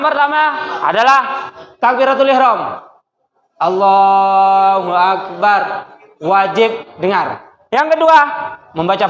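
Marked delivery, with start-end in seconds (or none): 3.6-5.6 very slow, sustained chant; demonstrating takbir. Shown as a demonstration. Teacher showing students an audible takbir, slow chant. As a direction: hold the long vowel, evenly chanted.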